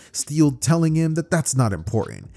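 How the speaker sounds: ordinary delivery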